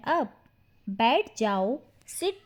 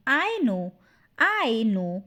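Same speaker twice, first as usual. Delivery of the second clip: usual speaking voice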